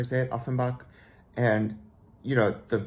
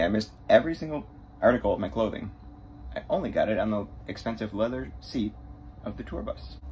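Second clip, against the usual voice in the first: normal talking voice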